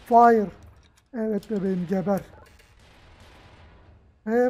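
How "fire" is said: loud single-word call; shouted command. Real, not acted. Player giving the order to fire, shouting.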